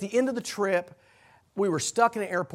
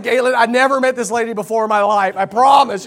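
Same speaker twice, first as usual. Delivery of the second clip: laughing protest; 5 semitones higher, 12 dB louder than usual. Retold or imitated. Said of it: "and I'm just like".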